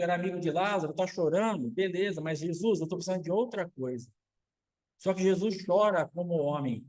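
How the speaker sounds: ordinary talk, nothing marked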